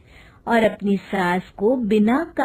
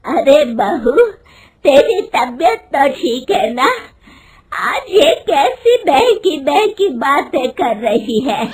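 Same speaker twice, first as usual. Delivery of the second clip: louder, higher angry mother-in-law; angry scolding; 4 semitones higher, 5 dB louder than usual. Acted. Mother-in-law scolds daughter-in-law about job, angry and loud. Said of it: "गुस्से में कहती है".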